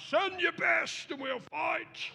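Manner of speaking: high, slow shouted taunt; hammed-up Goliath shout; mock giant challenge. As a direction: bellow the challenge across the field. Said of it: "he runs through his thing".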